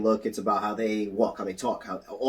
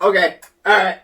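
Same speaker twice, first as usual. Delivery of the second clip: retold startled acceptance; 8 semitones higher, 10 dB louder than usual. Retells own startled reaction.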